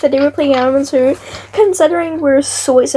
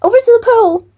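excited urging; 10 semitones higher than usual. Real, not acted. Urging friend in the game.

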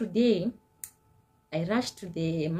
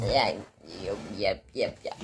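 low voice; 13 semitones lower than usual. Real, not acted.

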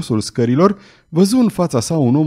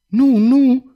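loud, higher cry of no; 11 semitones higher, 4 dB louder than usual. A narrator's reading. Girl crying out in fear as Belgoder circles, loud and high. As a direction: cry out in fright. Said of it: "strigă ea".

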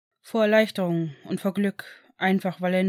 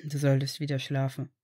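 gently; 6 semitones lower than usual. A narrator's reading. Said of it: "sagte Severus dann sanft".

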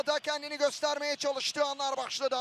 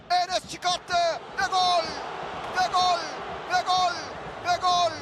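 screaming football commentator; slower than usual. Real, not acted. Equaliser goal called.

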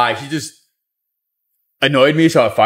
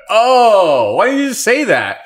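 naive mock reply; 9 semitones higher, slower than usual. Fired worker's naive reply.

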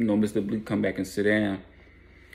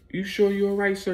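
higher, caring woman's voice; concerned waitress; 10 semitones higher than usual. Retold or imitated. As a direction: ask it like a worried waitress. Waitress checks on him.

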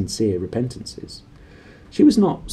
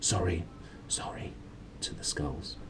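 soft whispered apology to the skulls; 3 semitones lower, 15 dB quieter than usual. A narrator's reading. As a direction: breathe sorry almost silently. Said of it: "and whispered".

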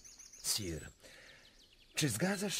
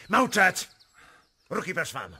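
sudden bark, much louder and higher; commanding shout; 8 semitones higher, 8 dB louder than usual. Acted. The prince silences Stackelberg's shouting with a roar. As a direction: silence the whole hall in one blast.

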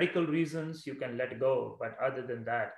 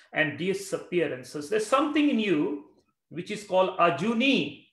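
preaching; 5 semitones higher, 5 dB louder than usual. Acted. Rising sermon about inner witness.

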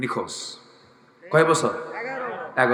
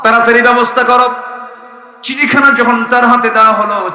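full-voice preaching; 9 semitones higher, 12 dB louder than usual. Acted. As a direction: preach it out loudly on a raised, sustained pitch. Preaches in a loud chant.

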